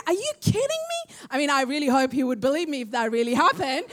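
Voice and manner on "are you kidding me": high, disbelieving yelp of a stunned fiance; mock shocked fiance asking if she's joking; incredulous fiance. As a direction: play the fiance who can't believe his ears, pitched way up. Fiance hearing of the angel.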